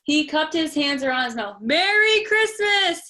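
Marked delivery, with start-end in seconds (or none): 1.6-3.1 slow, high, loud shout. Acted. Conductor shouting from the moving train. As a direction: shout it through cupped hands. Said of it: "he shouted".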